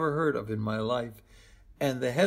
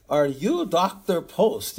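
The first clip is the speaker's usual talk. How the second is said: put-on slow, higher Eastern European accent, a bit louder; 4 semitones higher, 5 dB louder, slower than usual. Stranger at the escalator stops the doctor, in a mock Eastern European accent. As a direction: imitate a stern Eastern European stranger checking your name. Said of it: "He had a very Eastern European accent".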